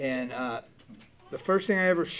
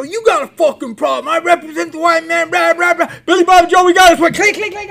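much higher and louder, building to a yell; higher, louder hammed-up cop rant that builds; mock officer rant; 12 semitones higher, 11 dB louder than usual. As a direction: ham up a sneering cop growing louder. Officer rants mocking the parolee.

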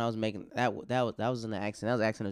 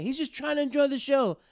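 high, loud pleading protest; indignant family; 6 dB louder than usual. Retold or imitated. The deaf man's family protesting to the comic, high and loud. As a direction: plead loudly on his behalf.

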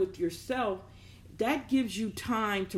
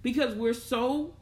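ordinary delivery

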